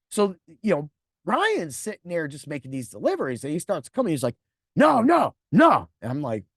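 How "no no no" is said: sharp loud no, no, no; loud refusal. Retold or imitated. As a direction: push back hard at the manager. Coworker refuses loudly.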